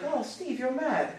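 put-on high, soft English accent of a wife; imitated English accent. Newly married English wife teasing her husband, imitated English accent. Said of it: "she used to talk like that".

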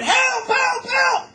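high, loud, desperate screams; screaming for help. Retold or imitated. Boy chased by dogs screams to the crowd, desperate shout. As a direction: yell for help as if your life depends on it. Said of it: "I'm screaming".

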